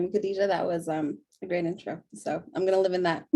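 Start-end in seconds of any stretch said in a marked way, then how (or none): none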